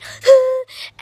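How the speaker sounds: loud, high laugh burst; real laugh. Real, not acted. Laughs after acted plea.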